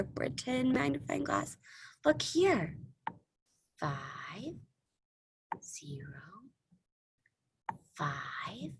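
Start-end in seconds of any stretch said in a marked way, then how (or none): 3.8-8.7 whispering. Acted. Hushed look with pretend magnifier.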